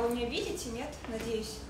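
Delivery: normal talking voice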